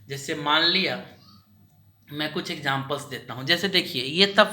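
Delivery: usual speaking voice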